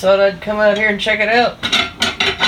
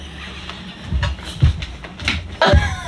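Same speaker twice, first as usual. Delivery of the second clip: very high squealing laugh; breathless shrieking laugh; helpless laughter; 5 dB quieter than usual. Real, not acted. Helpless laughter over the mistake.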